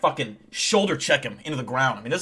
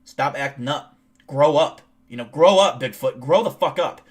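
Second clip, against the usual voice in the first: mock-stern scolding, getting louder; stern warning; 7 dB louder than usual. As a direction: lay down the law, hitting "grow up" harder. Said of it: "I give him the warning".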